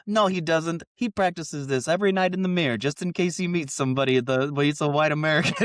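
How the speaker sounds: imitated wife cutting in loudly; calling him out. Wife calls out husband's boast.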